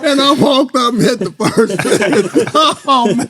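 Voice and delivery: high, loud, laughing punchline; real laughter at his own story. Real, not acted. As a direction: crack up at the payoff.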